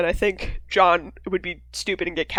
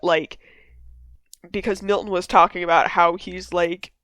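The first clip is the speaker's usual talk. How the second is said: normal talking voice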